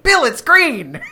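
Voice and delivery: high, loud nagging call; nagging parent impression. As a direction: blurt a warning like mom. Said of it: "I went".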